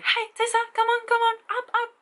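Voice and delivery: very high sing-song call to a small dog. Retold or imitated. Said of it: "he just called Tessa".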